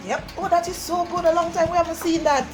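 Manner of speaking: ordinary talk, nothing marked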